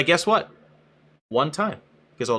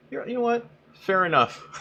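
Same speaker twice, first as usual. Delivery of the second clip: higher, sheepish mock-streamer voice; meek streamer; 11 semitones higher than usual. Streamer caving meekly to a viewer's scolding, in a higher mock voice. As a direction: play a pushover streamer caving at once. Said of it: "What Twitch streamer's like".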